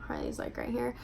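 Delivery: hushed whisper so the dog isn't disturbed; whispering about the dog nearby. Real, not acted. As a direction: drop to a breathy whisper. Dog right beside her.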